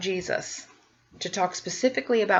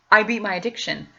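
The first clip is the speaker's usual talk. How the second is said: ordinary talk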